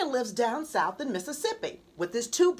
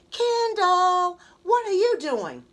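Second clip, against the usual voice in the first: loud, higher call; 5 semitones higher, 6 dB louder than usual. A narrator's reading. Brother bursting into sister's room, loud call. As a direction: burst in calling her name.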